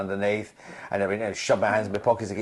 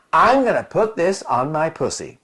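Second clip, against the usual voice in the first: mock American actress accent, slightly higher; bad American accent; 4 semitones higher than usual. Demure actress announcing to the crew, in a terrible American accent. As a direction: do a deliberately rough American accent. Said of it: "I did the terrible accent".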